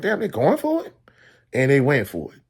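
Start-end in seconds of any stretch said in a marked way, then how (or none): 0.0-0.9 high, disbelieving exclaim; retold stunned surprise, pitched high; surprised. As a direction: let the voice jump up in shock. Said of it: "I'm like".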